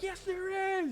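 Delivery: mock-offended squeak insisting there is; pissed-off retort. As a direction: snap back in a silly high voice. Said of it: "And I'm gonna be so pissed off".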